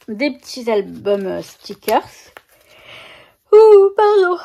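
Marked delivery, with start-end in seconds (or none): 3.5-4.4 startled yelp. Real, not acted. Startled while handling sticker books.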